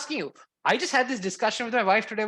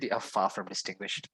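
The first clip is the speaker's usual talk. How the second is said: quoting wife; 14 semitones lower, 9 dB quieter than usual. Retold or imitated. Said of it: "and she said".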